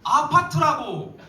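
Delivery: loud, high furious shouting; angry yell. Retold or imitated. Sister yelling at her singing brother, furious. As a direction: yell at him to shut up. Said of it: "또 말하는 거예요".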